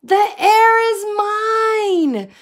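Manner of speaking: very high, loud scream; screaming frog. A narrator's reading. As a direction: scream it up at the sky. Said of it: "screamed Lydia".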